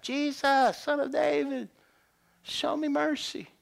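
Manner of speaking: high, pleading call; crying out. Acted. Blind beggar begging Jesus for mercy, crying out. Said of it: "That's what he cried out".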